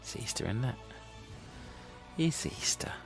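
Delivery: normal talking voice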